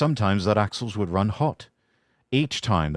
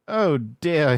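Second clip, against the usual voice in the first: sad; 8 semitones higher, 3 dB louder than usual. Acted. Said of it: "he thought sadly".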